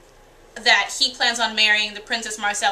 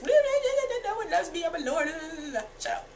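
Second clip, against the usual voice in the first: whiny Theon; 6 semitones higher, 6 dB quieter than usual. Retold or imitated. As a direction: laugh while you mimic a sulking lord. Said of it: "because he just".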